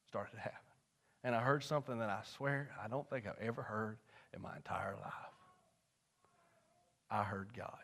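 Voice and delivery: low, near-whispered confiding, with long pauses; hushed testimony. Real, not acted. Preacher confiding his conversion to the congregation, hushed. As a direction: bring it right down, reverent and halting.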